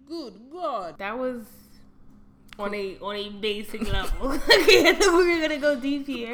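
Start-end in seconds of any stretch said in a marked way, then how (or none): 4.3-6.3 loud, high laughing words. Real, not acted. Laughing at the short answer.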